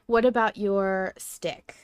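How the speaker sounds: ordinary talk, nothing marked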